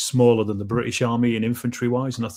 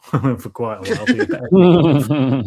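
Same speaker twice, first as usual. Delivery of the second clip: laughing; 2 semitones higher, 7 dB louder, much slower than usual. Real, not acted. Laughs about pausing French.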